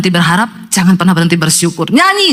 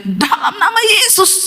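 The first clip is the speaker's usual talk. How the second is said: husband's mock yell; 10 semitones higher, 3 dB quieter, slower than usual. Possessed husband during his wife's prayer, high drawn-out mock yell. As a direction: imitate a slow high cry of release. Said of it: "suaminya teriak".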